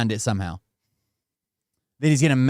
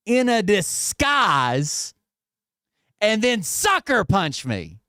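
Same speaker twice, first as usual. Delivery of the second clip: slow, higher mocking retelling of the threat; sarcastic recap; 4 semitones higher, slower than usual. Streamer mockingly spelling out Bert's sneak-attack plan, slow and sarcastic. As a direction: drag it out like it's absurd.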